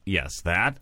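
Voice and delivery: usual speaking voice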